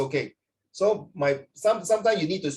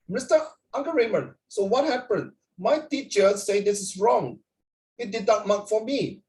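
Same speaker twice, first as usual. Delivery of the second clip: put-on higher-pitched light Indian-accented student voice; slight Indian accent; 6 semitones higher than usual. Indian student complaining to his tutor about marks, slight Indian accent. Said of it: "he got a bit of Indian accent".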